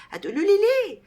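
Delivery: high, loud rhetorical question; teacher teasing the class with why; excited question. Real, not acted.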